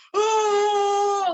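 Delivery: long, loud, high wail to the sky; anguished wail. Retold or imitated. Big guy on his board, wailing at the gods. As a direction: cry out like cursing the gods. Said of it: "does this".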